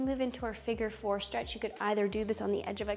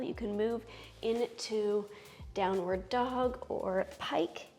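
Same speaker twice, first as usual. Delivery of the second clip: soothing yoga teacher; 5 dB quieter, slower than usual. Acted. Guides yoga poses slowly.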